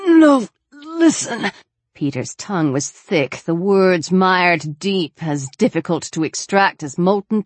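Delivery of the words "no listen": pained insistence. Acted. Wounded man insists on speaking.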